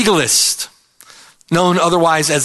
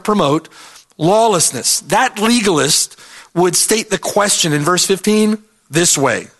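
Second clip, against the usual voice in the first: usual speaking voice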